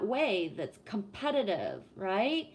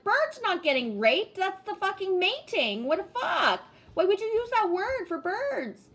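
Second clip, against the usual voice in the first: higher, louder exasperated scoff; incredulous retort; 9 semitones higher, 4 dB louder than usual. Retold or imitated. Answering the woman about birds, incredulous and exasperated. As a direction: scoff at her, disbelieving. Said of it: "And I was like".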